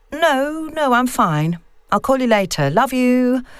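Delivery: a daughter trying to sound normal; nervous daughter on phone. Acted. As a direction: sound uneasy but casual to your mum. Vicky phones her mum.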